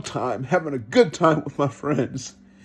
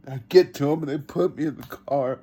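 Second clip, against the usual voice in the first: ordinary delivery, nothing marked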